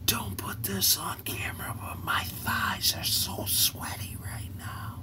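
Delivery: confiding whisper, secret aside; hushed confession off camera; leaning-in whisper. Retold or imitated. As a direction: whisper it like nobody should hear. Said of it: "she leans over and goes".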